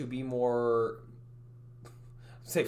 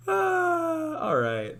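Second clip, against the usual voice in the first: 1 Italian accent goodbye; 12 semitones higher, 5 dB louder, much slower than usual. Shown as a demonstration. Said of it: "go a full Italian".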